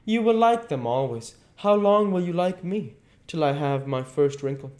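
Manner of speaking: hurt and jealous, voice rising; a hint of a flushed angry youth; almost angry. A narrator's reading. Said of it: "He seemed almost angry".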